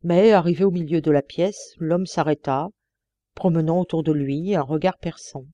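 ordinary delivery

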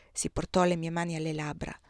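ordinary delivery, nothing marked

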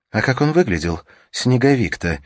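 high, loud panicked cry. Acted. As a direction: press him, frantic and scared. Panicked mother questioning her son.

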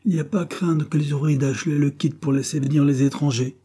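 storyteller voicing growing irritation; irritated. A narrator's reading. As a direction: argue back testily. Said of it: "toujours plus irrité".

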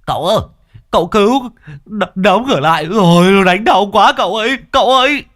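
high, loud panicked cries; frantic loud shout for the master; shouting for help. Acted. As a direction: yell from the gate in a panic. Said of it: "vừa đến cổng đã hô to".

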